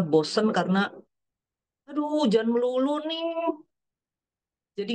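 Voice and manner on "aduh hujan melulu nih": higher, drawn-out mock moan; acted weary grumble, stretched out; complaining. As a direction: grumble slowly with a long, pitched-up whine. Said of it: "makin kita berkeluh kesah".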